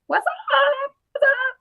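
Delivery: very high squeak that breaks; voice cracking. Real, not acted. Greeting a viewer from New Jersey, real squeaky voice crack. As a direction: shoot up high until the voice cracks. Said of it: "My voice kinda cracked there".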